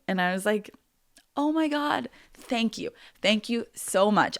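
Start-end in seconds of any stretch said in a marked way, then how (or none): 1.3-4.4 high, acted burst of gratitude; gushing thanks. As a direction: re-enact being thrilled and thankful. Acts out grateful reaction to offer.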